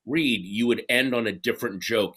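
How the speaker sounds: ordinary delivery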